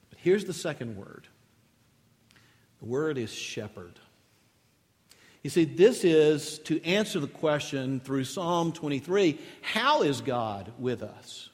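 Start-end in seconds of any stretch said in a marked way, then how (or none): none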